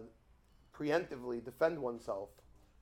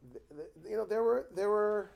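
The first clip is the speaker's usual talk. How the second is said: ordinary talk, nothing marked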